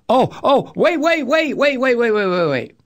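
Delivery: high, rapid-fire protest tumbling back down; mock alarm. Host imitating the first reaction to the news, mock alarm. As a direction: imitate someone frantically halting the conversation.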